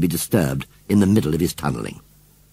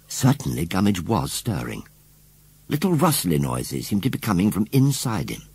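ordinary delivery

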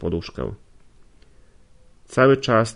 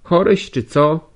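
high, loud, slow blunt question; a bossy servant prodding him; servant woman's voice; 7 semitones higher, 7 dB louder than usual. Acted. Nastazja questioning the sick young man, high and loud.

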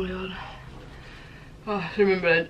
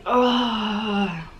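pained gasp; 3 semitones higher, 6 dB louder than usual. Real, not acted. Gasping after hot spice.

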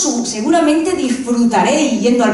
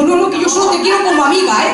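God letting her down; 6 semitones higher, faster than usual. Retold or imitated. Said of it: "bajó Dios y me dijo".

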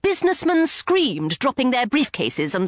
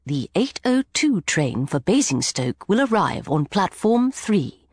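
even, unhurried public-address read; a hint of a railway announcer; calm station announcer; 6 semitones lower than usual. A narrator's reading. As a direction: announce it like the tannoy, lightly voiced. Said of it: "An announcer's calm voice".

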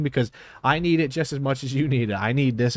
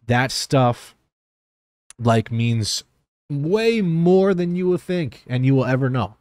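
almost crying; 3 semitones higher, slower than usual. Real, not acted. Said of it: "me almost crying again".

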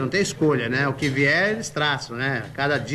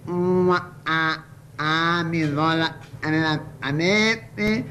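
stutterer; slower than usual. Acted. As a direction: stall on each word.

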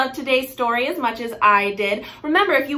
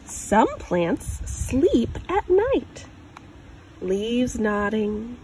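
put-on reading to a child. Parent reading a picture book aloud, gentle storytelling. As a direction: read it like a picture book for a little one.

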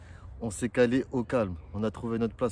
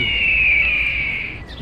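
loud, very high call across the pitch; 8 dB louder than usual. Real, not acted. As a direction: hail someone far away. Calling out to the coach. (appel crié de loin)

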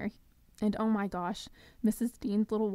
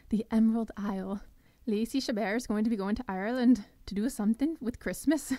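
badly faked Irish accent; horrible Irish accent; 3 dB louder than usual. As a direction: put on a hammy, clumsy Irish lilt. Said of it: "that was a horrible, horrible Irish accent".

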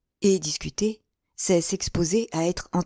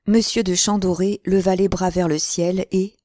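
ordinary delivery, nothing marked

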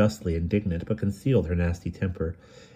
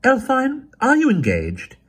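stern, sharp and louder; severe; 6 dB louder, slower than usual. A narrator's reading. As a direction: demand it severely. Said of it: "Flora said severely".

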